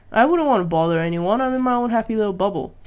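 ordinary talk, nothing marked

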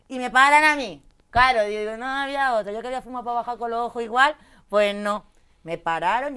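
high, loud exasperation; loud disbelief at the airport stop; indignant outburst. Real, not acted. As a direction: vent that they stopped her again. Indignant climax, stopped again.

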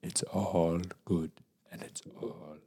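very soft, slow mock Native elder accent; monotone Native accent. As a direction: try a hushed, monotone Native accent, pausing between phrases. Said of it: "very monotone".

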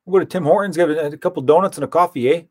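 friendly Canadian accent offering coffee, playing it up; Canadian accent, jokey impression. Shown as a demonstration. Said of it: "That's pretty good".

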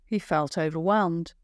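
ordinary delivery

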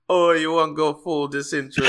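high, loud mock Jamaican lilt, laughing through it; mock Jamaican accent. Mimicking cohost's Jamaican take on crypto, laughing Jamaican accent. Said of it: "like, with a Jamaican?".

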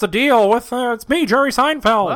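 high-pitched comedian impression; exaggerated, high stand-up whine; Jerry Seinfeld. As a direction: pitch it up like a hammy observational comic. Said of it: "What does the Bee from the Bee Movie sound like".

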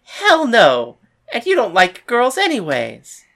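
outraged rival; indignant refusal. Acted. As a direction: shoot the bet down flat out.

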